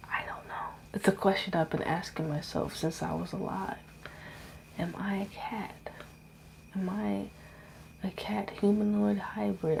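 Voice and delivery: breathy whispered musing. Real, not acted. Wondering aloud to viewers if she is a cat, whispered. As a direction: whisper it to yourself, half joking.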